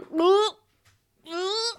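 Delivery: high held-breath grunts; comic cloud holding its breath; held-breath noise. Acted. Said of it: "The cloud is going".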